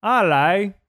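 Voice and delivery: loud, high, rising cry; showy exclamation. Shown as a demonstration. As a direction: belt it out as a party trick. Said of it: "Check this out".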